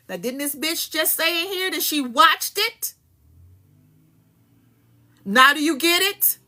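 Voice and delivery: high, loud, furious rant; heated rant. Real, not acted. To the audience about the watching assistant, a loud heated rant. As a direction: let the anger boil over at her. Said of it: "So I'm getting heated".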